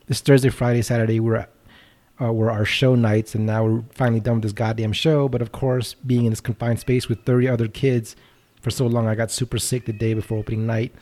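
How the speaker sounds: slow, measured read-aloud of a listener's note; reading a message. Acted. Host slowly reading a listener's rant on air.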